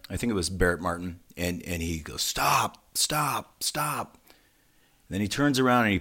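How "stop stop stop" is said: retold halt command. Singer halting his drummer mid-song, retold mock command. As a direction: imitate cutting someone off, word by word.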